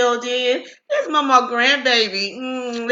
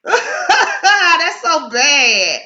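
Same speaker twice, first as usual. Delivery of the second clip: laughing; 4 semitones higher, 4 dB louder, much slower than usual. Real, not acted. Laughing at her bad stream connection.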